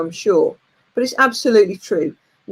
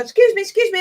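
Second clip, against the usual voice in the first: mock calling out; 10 semitones higher, faster than usual. Calling to ride staff.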